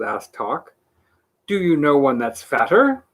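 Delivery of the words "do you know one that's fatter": plain reading voice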